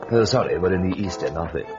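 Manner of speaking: ordinary delivery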